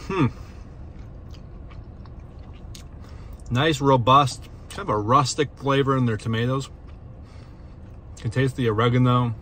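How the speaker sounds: chewing while talking; mouth full of pizza. Real, not acted. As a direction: food in mouth. Seen 0: bites slice at -4, jaw working with lips closed throughout.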